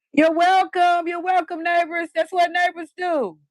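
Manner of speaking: high sing-song mock neighborly call; sugary high voice thanking neighbors. Calling across street to neighbors.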